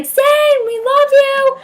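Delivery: high, loud fangirl scream; fan scream. Retold or imitated. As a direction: shriek it like you're losing it. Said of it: "I was screaming his name".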